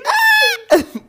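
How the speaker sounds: very high, loud shrieking laugh. Real, not acted. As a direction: burst out screaming with laughter. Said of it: "sí grité un poquito". (carcajada chillona y muy fuerte)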